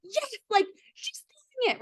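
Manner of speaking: high, bright-eyed proud mother cheering; proud mom voice. Retold or imitated. Imagining mom cheering her daughter at the yoga test, high and proud. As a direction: cheer like your mom watching you succeed. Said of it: "like, yodeling".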